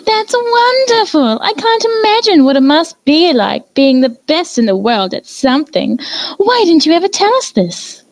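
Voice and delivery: very high, delighted refined voice; gushing Rarity. A narrator's reading. Rarity delighted by Fluttershy's title, very high and gushing. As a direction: swoon over the news.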